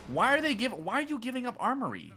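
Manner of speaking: high-pitched baffled question; incredulous. Real, not acted. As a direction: question their play in disbelief, voice shooting up. Baffled by team abandoning position.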